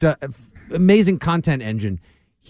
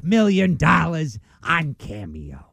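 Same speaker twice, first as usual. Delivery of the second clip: slow, drawn-out comedian impression; slow caricature of a comedian; Gilbert Godfrey. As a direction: stretch the words out in a put-on comic voice. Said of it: "Gilbert Godfrey's made nearly a half million dollars".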